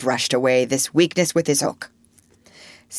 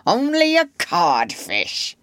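slow, drawn-out, falling dismissal; Hook; slower than usual. A narrator's reading. Peter taunts Hook from hiding, slowly pronouncing it. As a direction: draw the insult out like a pompous captain. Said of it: "answered in Hook's voice".

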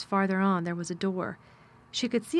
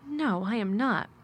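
plain reading voice

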